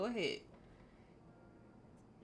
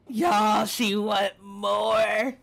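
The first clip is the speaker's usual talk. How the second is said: much louder, stretched-out hype yell; excited shout; 13 dB louder, much slower than usual. Real, not acted. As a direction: shout it to the chat, slow and loud.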